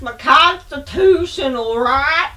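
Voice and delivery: high, loud, slow hammed-up indignation; exaggerated put-on protest; mock outraged anti-masker. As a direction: play a self-righteous complainer, stretching the words.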